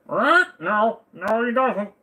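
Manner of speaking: high squeaky voice. Pretend. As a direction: squeak it out like a bird. Answers bird question in silly voice.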